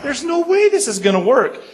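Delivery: put-on stunned teen losing faith in the plan, pitched high; exasperated. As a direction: throw the line up high in disbelief. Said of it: "I'm like".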